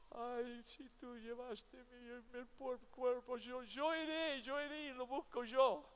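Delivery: a touch of tearful pleading, rising; tearful plea. Acted. Mary begs the stranger for the body, lightly tearful. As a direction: plead through mild tears. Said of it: "a través de sus lágrimas".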